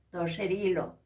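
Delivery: ordinary talk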